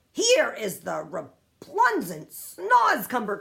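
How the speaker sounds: loud, slow cry. A narrator's reading. Giant waving the snozcumber at Sophie, crying out loud. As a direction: proclaim it loudly, drawn out. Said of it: "cried the B F G".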